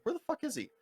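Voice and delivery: high, soft incredulous squeak; exasperated. Retold or imitated. Narrator waiting for the late driver, high and soft. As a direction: squeak it out in disbelief.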